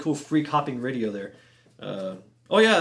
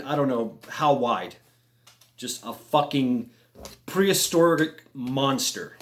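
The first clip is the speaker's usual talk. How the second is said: usual speaking voice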